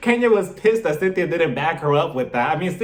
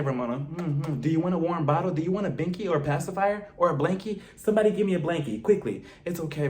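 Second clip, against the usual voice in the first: put-on softer, hushed voice like soothing a crying baby; mock baby soothing; 6 dB quieter than usual. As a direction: coo at her like she's an infant. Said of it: "holding Ramona like a baby".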